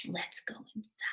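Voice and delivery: soft unvoiced whisper. A narrator's reading. Wallace whispers to Albert as they arrive, hushed. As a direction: breathe it like a secret. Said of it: "Wallace whispered".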